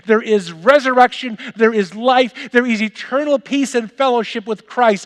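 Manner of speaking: high and loud, declaring each line; triumphant preaching. Acted. Pastor proclaiming eternal life to his church, high and loud. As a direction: ring out the victory.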